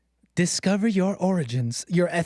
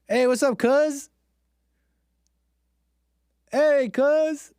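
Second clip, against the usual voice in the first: greeting; 8 semitones higher, 3 dB louder, slower than usual. Pretend. Greeting cousins in photos.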